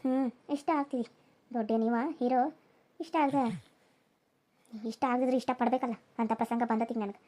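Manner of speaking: low, hushed grumble to herself; mock the husband quietly under her breath; muttered sour aside; sarcastic aside.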